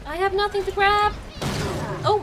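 high, loud comic complaint; desperate cry. Pretend. Player with nothing left to grab, desperate cry. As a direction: call out in mock despair.

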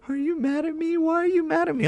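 very high, squeaky imitation of the wife's worry; mock the wife in a tiny high voice; teasing high-pitched whiny impression; imitating wife. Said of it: "[name]'s like".

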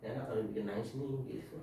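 soft quick aside, mildly teasing; mock muttering. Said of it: "papa juga kesel". (gumaman pelan menggoda)

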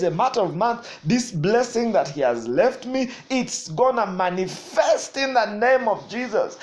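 loud, fervent preacher declaring; full-force preaching. Acted. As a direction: proclaim it to the congregation.

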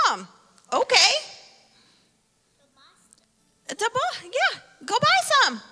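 high, loud mock-whiny son. A son whining at his mom, high and loud. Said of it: "He's like".

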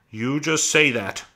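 high, slow, pointed rebuke; sharply. A narrator's reading. Aunt Clara dismisses Lenny's promise to leave, sharply. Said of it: "she said sharply".

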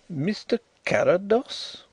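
high, slow questioning of the host; inquiring. A narrator's reading. Visitor asks the blind host, lightly high and inquiring. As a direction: ask it as a careful query. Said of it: "he said inquiringly".

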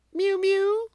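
very high mewing cat. A narrator's reading. Simpkin scratches at the door, mewing high. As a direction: mew like an impatient cat. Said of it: "interrupted Simpkin".

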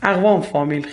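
ordinary talk

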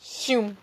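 high-pitched imitated whoosh of a shot; silenced gunshot noise. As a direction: mimic a silenced sniper shot. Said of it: "You just hear this".

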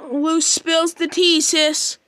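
normal talking voice

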